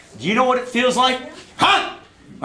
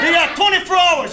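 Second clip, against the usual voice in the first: furious threat; 3 semitones higher than usual. Acted. Uncle shouting a threat at the man who owes money. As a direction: bark the deadline like you mean it.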